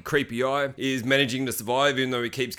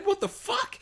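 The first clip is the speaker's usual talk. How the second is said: very high, softer squeak; shocked disbelief; 5 dB quieter, slower than usual. Retold or imitated. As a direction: squeak it out in mock horror. Disbelief at gory head birth.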